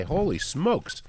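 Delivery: ordinary talk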